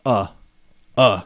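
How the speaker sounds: "Uh" as a short, lowly article A; "Uh", the weak A, set apart; improper A. Shown as a demonstration. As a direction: say A as a curt "uh". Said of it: "don't even get the proper A".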